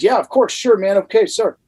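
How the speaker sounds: put-on a scared kid quickly agreeing; eager young me. Eager reply to yelling dresser.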